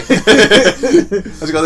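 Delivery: slow, high laugh-choked question; can barely get the words out for laughing; laughing mock Aussie question. Real, not acted. Laughing through mock Aussie game.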